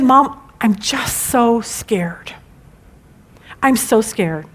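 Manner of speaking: slow, breathless frightened whisper; scared whisper. Retold or imitated. Daughter confessing fear, retold.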